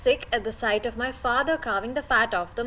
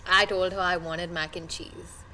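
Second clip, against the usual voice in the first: usual speaking voice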